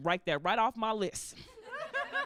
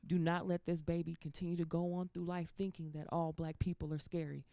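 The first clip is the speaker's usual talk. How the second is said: low, soft grandmotherly admonishing; measured, hushed elder's lecture; grandmother's counsel; 5 semitones lower, 6 dB quieter than usual. Acted. Grandmother advising on a teachable moment, low and soft. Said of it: "I could hear my grandmother say".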